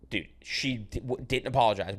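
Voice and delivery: normal talking voice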